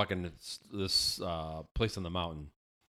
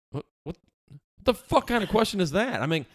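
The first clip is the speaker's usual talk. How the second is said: high, incredulous rant; exasperated, high-pitched protest; comic indignation; 11 semitones higher, 5 dB louder than usual. Retold or imitated. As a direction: sputter in outraged disbelief. Indignant reaction to woman's question.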